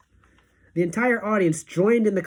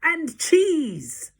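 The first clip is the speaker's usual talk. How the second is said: much higher shouted answer; gleeful shout; 10 semitones higher than usual. Acted. Grand High Witch answers her witches, gleeful high shout. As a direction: fling it out with glee. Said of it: "shouted the Grand High Witch".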